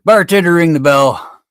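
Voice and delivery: loud, high call to the bartender. Acted. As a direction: call across the bar. Customer calls out in joke.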